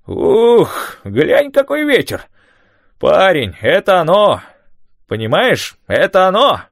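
put-on high, loud, thrilled old man; excited old man. A narrator's reading. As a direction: shout it over the wind, elated. Tom excited by the storm.

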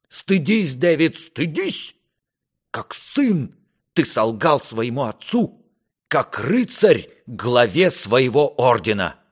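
high, loud outburst of reproach; indignant rebuke. Acted. Father shames his son for lying, loud indignant rebuke. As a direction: shame him with full-voiced indignation.

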